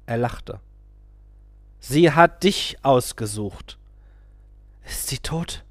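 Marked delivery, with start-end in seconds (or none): 1.8-3.8 slow, loud emphatic claim; a guru insisting that she chose him. A narrator's reading. 4.8-5.6 put-on a man asking if the girl is dead; trembling voice. A narrator's reading. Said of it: "fragte ich mit bebender Stimme".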